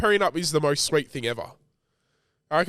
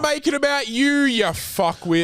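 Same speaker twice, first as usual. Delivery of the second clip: mock outrage; 11 semitones higher than usual. Mock ranting at the proposer.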